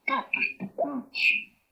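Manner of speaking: Tatus tu cuchi high and slow; demo phrase. Shown as a demonstration. Teacher voices the bedtime phrase to the class, high and slow. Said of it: "que dice".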